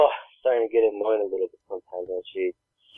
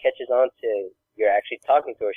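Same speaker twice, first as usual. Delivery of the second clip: usual speaking voice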